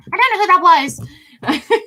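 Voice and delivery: very high, loud cartoonish voice; squeaky joke voice. Streamer joking after a meme quote, high squeaky voice. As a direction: squeak it loudly, breaking into laughter.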